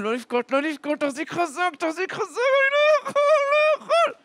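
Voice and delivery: building from high urging to a very high, loud wail; desperate struggle. Acted. Terrified boy before the soldier, desperate struggle. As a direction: strain harder until it breaks into a cry.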